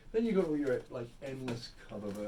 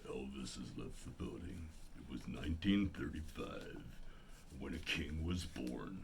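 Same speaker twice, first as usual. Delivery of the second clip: [name]; 9 semitones lower, 5 dB quieter than usual. Acted. Bass singer narrates the King's life, deep narrator impression. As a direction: drop right down into a deep bass tribute voice. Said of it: "That's [name]".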